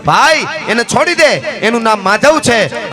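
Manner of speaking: acted chief's cry, drawn out at the end; commanding call. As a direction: call out an urgent command to your man. Outlaw chief orders his man.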